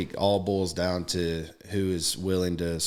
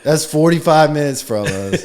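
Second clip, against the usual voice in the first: higher and louder, incredulous; mock outrage; 7 semitones higher, 9 dB louder than usual. To his friend about the long drive, loud incredulous outburst. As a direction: throw it back at him in disbelief. Friend stretched a short ride far.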